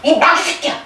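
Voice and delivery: mock angry father cursing his son; father's angry outburst. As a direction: snap at the son like an angry old pastor. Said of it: "이러시는 거예요".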